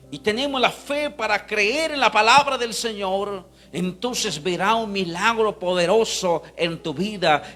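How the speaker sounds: loud, high preacher rising to a shout; fervent pulpit proclamation; full-force preaching, building. Acted. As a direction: build to a shouted climax for applause. Preaches to a climax.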